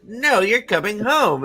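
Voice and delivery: mock bickering mother; insistent mother. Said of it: "and she's like".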